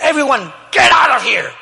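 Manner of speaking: high, loud shout in English. Retold or imitated. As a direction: bark everyone out of the room. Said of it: "imagínense que estoy yo".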